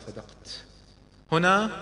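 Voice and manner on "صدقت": whispered yes, barely voiced; a soft hushed agreement; whispered reply. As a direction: whisper it gently. Prophet answers softly.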